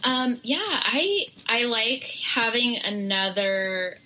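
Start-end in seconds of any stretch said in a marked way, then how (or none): none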